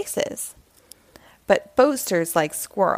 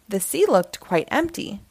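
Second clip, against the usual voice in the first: ordinary delivery, nothing marked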